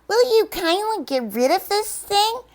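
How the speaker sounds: sly monkey request. Acted. Monkeys ask the eagle.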